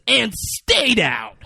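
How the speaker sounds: big, slow, high shout; triumphant mock shout. Man kicks the gun from Hugo, triumphant mock shout. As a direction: bellow it slowly, hamming up the moment.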